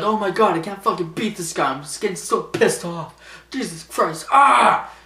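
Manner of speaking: high-pitched mock frustration building to a yell; John Cena. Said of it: "He's like".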